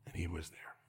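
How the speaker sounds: hushed reassuring whisper. Acted. Preacher whispers to the hurting that He saw them. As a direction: breathe it close.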